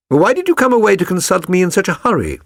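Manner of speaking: quick, lightly voiced Holmes question. A narrator's reading. Holmes pressing his client, quick and lightly voiced. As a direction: rattle it off as the sharp detective.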